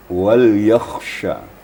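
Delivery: "wal yakhsha" drawn out slowly, kha and shin each clear; careful tajwid reading; slow Quranic recitation. Shown as a demonstration. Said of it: "huruf yang agak berat bacaannya".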